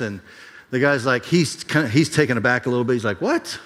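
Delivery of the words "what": surprised. Retold or imitated. Man taken aback.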